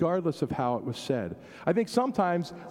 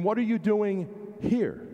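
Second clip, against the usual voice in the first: HERE set apart after a short hold, swooping in pitch; stress on HERE; 6 semitones higher than usual. Shown as a demonstration. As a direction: save the weight for "here". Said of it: "put the emphasis on a different place".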